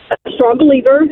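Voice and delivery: ordinary talk, nothing marked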